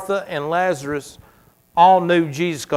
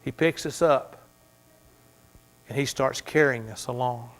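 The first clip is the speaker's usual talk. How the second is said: much softer, gentle comfort; tender; 3 semitones lower, 5 dB quieter than usual. Acted. Pastor to weary believers, soft and tender. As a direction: drop down and comfort them. Soft resolution after climax.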